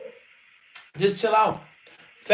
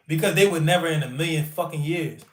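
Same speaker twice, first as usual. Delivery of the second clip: normal talking voice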